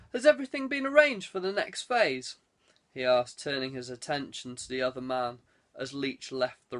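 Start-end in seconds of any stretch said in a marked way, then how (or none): 0.1-2.4 pitched-up questioning lord; lord asking. Acted. Lord asking Chamberlain about the next phase, lightly raised. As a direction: press him for an answer.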